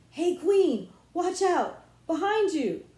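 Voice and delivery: high, loud cry of alarm; warning shout. Acted. King warning his queen of an attacker, high loud shout. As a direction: yell a warning mid-battle. Jess warning in mock battle.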